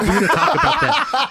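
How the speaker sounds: high, loud joking outburst; mock alarm. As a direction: blurt it out in pretend alarm. Quotes own mock-alarmed reaction.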